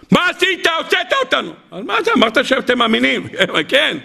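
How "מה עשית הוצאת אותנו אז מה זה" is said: pitched way up, accusing; high whining complaint. Acted. Israelites accusing Moses in a high whine. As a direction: whine at Moses like a scared crowd.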